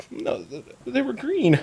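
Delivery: put-on very high, tearful little-girl voice; whimpering young girl. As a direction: whimper it in a high, small child's voice. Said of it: "she's, like, bawling her eyes out".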